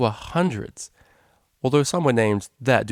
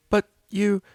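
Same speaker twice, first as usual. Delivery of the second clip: high, baffled blurt; startled; 12 semitones higher, 4 dB louder than usual. A narrator's reading. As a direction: caught off guard mid-question. Cellist shocked, voiced high.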